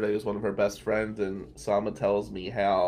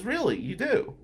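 imitated disbelief, much higher; mock surprise; 10 semitones higher than usual. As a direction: pitch it way up in amused disbelief. Colleague surprised he knows.

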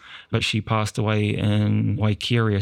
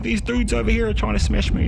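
agitated; 11 semitones higher than usual. Retold or imitated. Agitated insistence.

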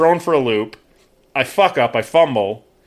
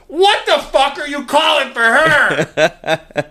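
higher, very slow, laughing mock anger; retold angry demand; 11 semitones higher, 3 dB louder, much slower than usual. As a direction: replay the outburst through laughter. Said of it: "It was like".

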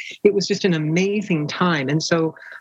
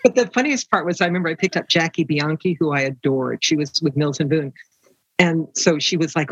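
ordinary delivery

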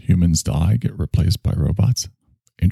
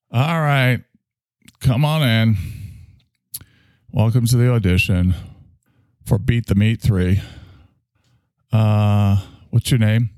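casting director; 4 semitones higher than usual. Pretend. Mock casting director audition scene.

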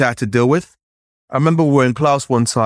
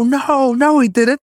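put-on children protesting; 13 semitones higher, slower than usual. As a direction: cry out in outrage like schoolkids. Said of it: "the children were like".

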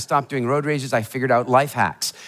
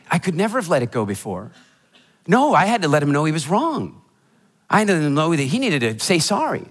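normal talking voice

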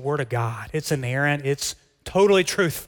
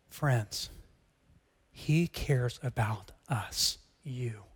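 hushed appeal; 5 semitones lower, 7 dB quieter, much slower than usual. Real, not acted. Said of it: "slow hushed appeal to congregation".

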